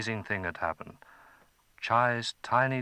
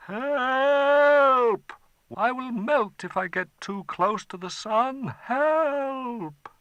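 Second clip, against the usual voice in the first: high, loud cries for help; a dragon shrieking in fear; panicked dragon; 6 dB louder, slower than usual. Acted. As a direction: dragon begging as he nears the sun. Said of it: "the dragon".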